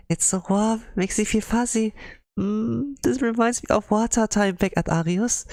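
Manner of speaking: cute high voice. Acted. Soft cute character voice.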